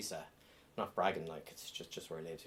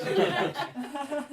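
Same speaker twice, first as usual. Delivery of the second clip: higher, very slow, laughing mum echo; 6 dB louder, much slower than usual. Retold or imitated. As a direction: stretch it out high, laughing as the mother. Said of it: "this isn't even my real voice".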